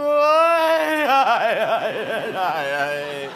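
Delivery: mock wailing. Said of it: "Tao khóc đó".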